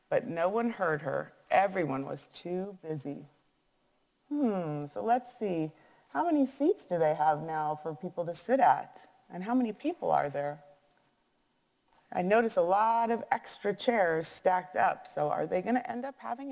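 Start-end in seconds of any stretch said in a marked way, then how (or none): none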